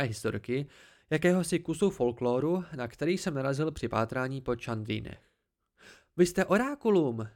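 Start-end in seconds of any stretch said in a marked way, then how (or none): 6.2-7.3 higher, louder sudden exclamation; blurted out. Acted. The boy blurts out his realization, higher and louder. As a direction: blurt it out as it dawns on you. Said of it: "vyhrkl jsem".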